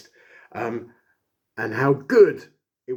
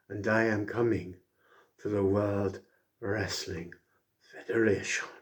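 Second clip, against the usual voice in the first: bad Razor Ramon accent; 4 dB quieter than usual. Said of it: "My accent is terrible".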